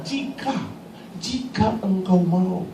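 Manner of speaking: softly voiced, slowed request; humble plea. Leper asking the Lord to heal him, humble soft plea. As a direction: drop to a soft, drawn-out plea.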